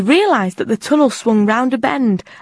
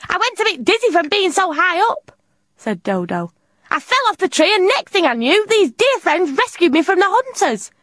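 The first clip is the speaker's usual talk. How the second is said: high, lightly voiced silly bird; a cheerful dodo telling her tale; Dodo's voice; 10 semitones higher than usual. A narrator's reading.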